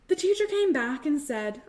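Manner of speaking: ordinary delivery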